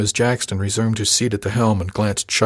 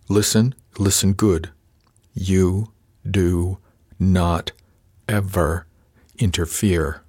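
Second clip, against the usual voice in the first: slow, one word at a time; measured menace, widely spaced words; deliberate threat; much slower than usual. A narrator's reading. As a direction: pause between words to drive the order home. Officer threatens junior word by word.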